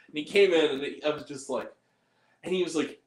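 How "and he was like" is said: ordinary talk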